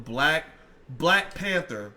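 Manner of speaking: each word slow and clear; slow and deliberate. Shown as a demonstration. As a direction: slowly spell out the code word. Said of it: "spelling out code word".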